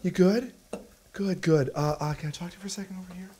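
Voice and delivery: Jason Bateman. Acted. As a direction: check on someone as if a step ahead. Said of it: "you're doing a legit Jason Bateman".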